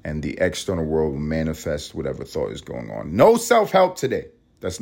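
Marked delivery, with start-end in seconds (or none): 3.2-4.3 emphatic. Real, not acted. Declaring what the show is not.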